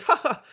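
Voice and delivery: a high, teasing little giggle; high mock giggle. Imitating Professor Pester giggling at the player. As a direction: giggle like a smug villain. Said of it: "He giggles".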